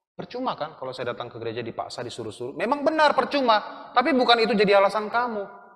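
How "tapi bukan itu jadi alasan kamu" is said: ordinary delivery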